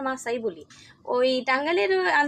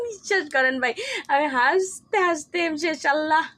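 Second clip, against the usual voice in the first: laughing; 5 semitones higher than usual. Real, not acted. Laughing at the memory.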